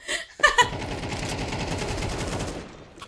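long airy giggle; breathy laugh. Real, not acted. Laughs at sounding zesty.

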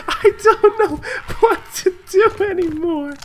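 high, breaking laugh through the words; laughing despair. Real, not acted. Gamer laughing in despair at the raid chaos.

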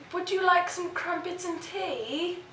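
put-on lightly done British accent; mock British accent. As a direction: play a prim Brit offering tea. Accent guessing game, British.

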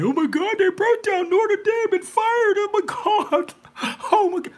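high, wailing mock sob; hammed-up high-pitched wailing; mock crying. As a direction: whine it like a hysterical fan. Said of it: "But y'all crying".

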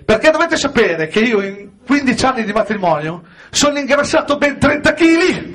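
ordinary talk

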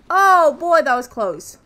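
normal talking voice

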